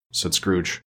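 usual speaking voice

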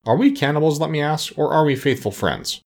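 scandalized storybook protest; shocked. A narrator's reading. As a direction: challenge them in shocked reproach. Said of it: "greatly shocked".

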